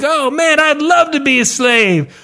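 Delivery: put-on high excited kid voice; hammed-up child enthusiasm; mock eager kid. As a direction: gush like a kid who wants it. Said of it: "They're like".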